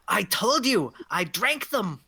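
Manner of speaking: put-on old hag's testy insistence; indignant old hag. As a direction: explain yourself as an affronted crone. Game master voices old hag.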